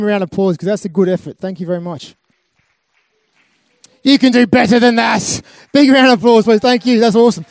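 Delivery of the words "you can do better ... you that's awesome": rally the room, pitch up and boom it out; loud crowd hype. Real, not acted. Speaker urging the festival audience to clap louder, loud and high. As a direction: call out loud and high to get bigger applause.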